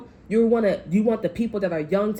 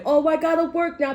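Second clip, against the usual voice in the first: mock whiny realization; 9 semitones higher, 4 dB louder than usual. Mocking a lazy person's reaction.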